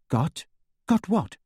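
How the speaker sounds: mildly jolted out of her thoughts; startled. A narrator's reading. Hermione answers Harry's sudden question, with a little start. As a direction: caught off guard, rising sharply on the repeat. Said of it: "she said with a little start".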